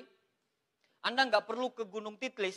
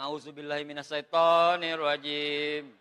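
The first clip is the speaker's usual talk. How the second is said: slow, loud, low recited chant; hammed-up drawn-out exorcism recitation; mock warding-off prayer; 7 semitones lower, 6 dB louder, slower than usual. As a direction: intone the prayer in comic dread, stretched out. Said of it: "Kalau istri lagi datang".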